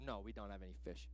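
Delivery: very low, soft, flat grumble; sullen fishermen. Retold or imitated. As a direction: drop it low like a sulking crew. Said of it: "They're like".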